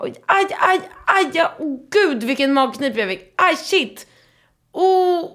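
sharp high yelps of mock pain; retold stomach cramp. Telling friend about her sudden stomach cramp, high pained yelps. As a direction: act out doubling over with cramps.

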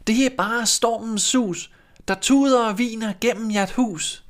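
put-on innocent children chanting back; children answering. A narrator's reading. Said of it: "Børnene svarede". (høje, syngende børnestemmer)

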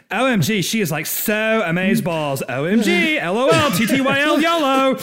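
put-on high, loud valley girl gush.

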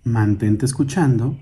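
calm, measured meditation guide with long pauses; guided meditation. Acted. Guide asking listeners to sit, breathe and hear their surroundings, guided meditation. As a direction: lead them gently through breathing and listening.

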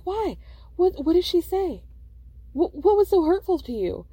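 high-pitched acted questioning; mock questioning. Acted questioning of crying friend.

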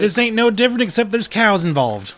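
loud, high, heated rancher rant; agitated rant. Acted. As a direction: rail at the sheriff, voice up high. Rancher ranting at sheriff.

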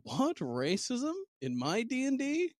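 high-pitched feigned disbelief; mock shock. Host joking about racism in the game, in mock shock. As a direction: act scandalised, pitch shooting up.

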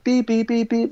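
high monotone beeps; repeated beeps held at one high pitch; imitated beeping. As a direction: mimic a horn going off repeatedly. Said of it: "keep hearing this beeping".

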